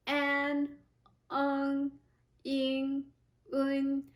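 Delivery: "an, eng, ing, un" each closing in a nasal, held evenly; front nasal finals. Shown as a demonstration. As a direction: end each of "an, eng, ing, un" through the nose. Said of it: "so it's front nasal sound".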